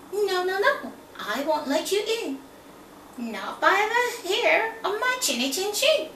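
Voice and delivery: high, loud little pig refusing. Acted. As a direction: squeak it out defiantly, high and loud.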